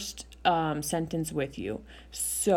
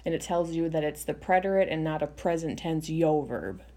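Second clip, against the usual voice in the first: ordinary talk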